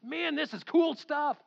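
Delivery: slow, high, loud delight; happy excitement. Retold or imitated. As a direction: reenact being thrilled, stretching the words. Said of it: "I was so happy".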